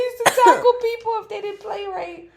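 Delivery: high, loud, squealing with laughter; helpless laughter. Real, not acted. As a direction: confess it while cracking up. Cracking up confessing game behaviour.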